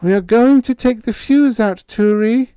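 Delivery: put-on high, loud, trembling call of a girl; quavering call. A narrator's reading. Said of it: "She called again".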